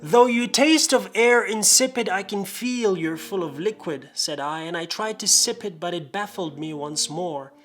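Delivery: rhythmic, theatrical verse reading; dramatic poem recital. Acted. Reading a drunken parody of The Raven, dramatic recital. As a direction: recite it with mock-gothic drama.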